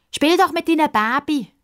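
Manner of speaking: put-on high, jeering small boy voice; mocking. Small boy mocking Pippa, high jeering voice. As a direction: tease her like a bratty little kid. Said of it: "spöttelt der kleine Bub".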